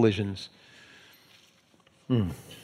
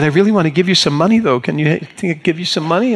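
higher, quick, eager offer; earnest insisting; 5 semitones higher, 3 dB louder, faster than usual. Retold or imitated. Young lady offering money after the spill, earnest insisting.

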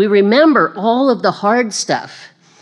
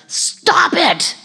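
high, exasperated donkey outburst; hammed-up indignant donkey protest; talking donkey; 11 semitones higher than usual. Donkey ordering Balaam to stop hitting it, exasperated. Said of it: "the donkey says".

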